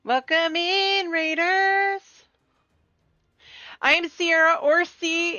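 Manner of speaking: high, slow drawn-out welcome; streamer welcome. Real, not acted. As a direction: stretch the welcome warmly.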